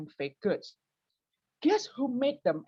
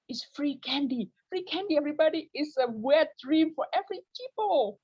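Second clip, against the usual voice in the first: higher, louder, quick glee; gleeful; 10 semitones higher, 4 dB louder than usual. Real, not acted. Comic gloating to the crowd about free candy, gleeful and high. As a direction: sell the joy of free candy.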